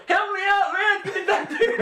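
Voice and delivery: very high, pleading shout; yelling plea. Retold or imitated. A friend pressed against the fence yelling to be let in. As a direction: holler for help through a fence. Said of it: "his fucking face yelling".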